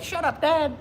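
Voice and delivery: high bird telling dad off; parrot voice. Retold or imitated. Mom's parrot sassing the father, high and cheeky. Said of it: "He'd be like".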